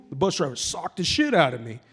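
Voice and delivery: normal talking voice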